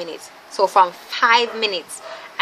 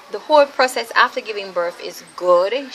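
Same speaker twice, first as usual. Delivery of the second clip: normal talking voice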